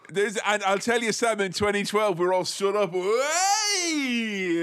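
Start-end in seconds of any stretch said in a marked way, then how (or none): 2.9-4.6 mock cheer. Mock celebration horse meat.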